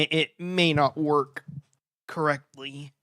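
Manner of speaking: ordinary talk, nothing marked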